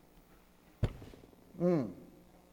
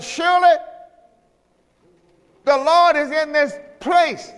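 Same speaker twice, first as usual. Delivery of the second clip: preaching; 6 semitones higher, 3 dB louder than usual. Acted. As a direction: lift it up like a revelation. Preacher reading scripture climax.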